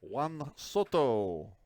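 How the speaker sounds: name drawn out slowly with a put-on Spanish accent. Shown as a demonstration. Host showing how everyone says the name, mock Spanish accent. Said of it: "must add some sort of weird accent".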